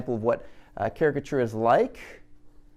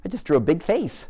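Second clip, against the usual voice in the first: high, louder eager list of big features; excited gushing; 8 semitones higher, 3 dB louder than usual. Retold or imitated. Teacher mimicking an overeager artist, excited and high. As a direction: gush over every feature like a thrilled beginner. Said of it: "and then I'm like".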